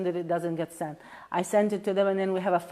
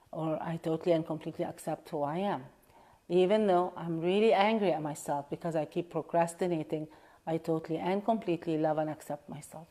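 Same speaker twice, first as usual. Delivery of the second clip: tapping setup statement; 3 semitones lower, 4 dB quieter than usual. Shown as a demonstration. Leading viewers through a tapping round, calm and steady. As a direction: recite it like a calm affirmation.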